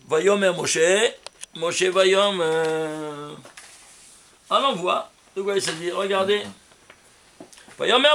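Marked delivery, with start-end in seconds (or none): 1.5-3.3 each word stretched very slowly; drawn out slowly. Shown as a demonstration. Teacher zooming in on the words for his students, very slow. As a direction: linger on Moshe Vayomer, syllable by syllable. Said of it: "on va faire un zoom sur ce".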